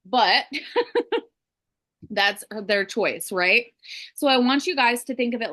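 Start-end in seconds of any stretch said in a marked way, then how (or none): 0.5-1.2 high-pitched little laugh; short laugh. Real, not acted. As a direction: laugh briefly mid-thought. Laughs after remark on public defender.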